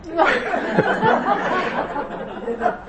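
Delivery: squealing high laugh. Real, not acted. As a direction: break into a high-pitched laugh. Laughing at the story.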